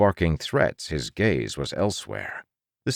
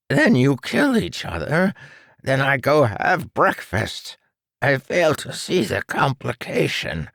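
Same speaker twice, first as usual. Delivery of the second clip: much higher, airy mad doctor; gleeful villain; 9 semitones higher, 3 dB louder than usual. Acted. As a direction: relish the cruelty, mock-casual.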